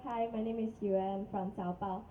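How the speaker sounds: normal talking voice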